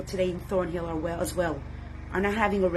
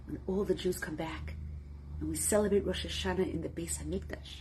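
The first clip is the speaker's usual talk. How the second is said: soft, low, intimate preaching; hushed sermon; 3 semitones lower, 8 dB quieter than usual. Acted. Rabbi addressing his community on Tisha B'Av, soft and intimate. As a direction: draw the congregation close quietly.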